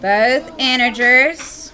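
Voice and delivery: slow, stretched out; drawn-out teaching emphasis. Shown as a demonstration. Teacher drawing out the words for the class, slowly. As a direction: lean on each word. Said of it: "teacher stretches words slowly".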